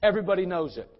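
ordinary talk, nothing marked

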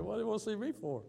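high, fast bewildered question. Retold or imitated. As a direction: rush it out, puzzled.

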